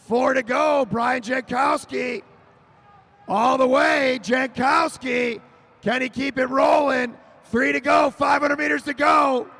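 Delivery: excited finish-line shout; shouted race call. Real, not acted. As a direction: yell the countdown over the crowd. Final laps shouted call.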